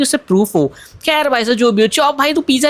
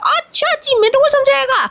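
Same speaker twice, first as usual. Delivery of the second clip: sarcastic aunty voice. Acted. Aunty scolds the boy.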